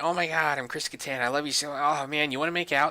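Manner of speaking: mock-eager hitting on a woman; imitating Chris Kattan. Chris Kattan flirting with hot chicks, mock gushing. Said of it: "all the hot chicks and be like".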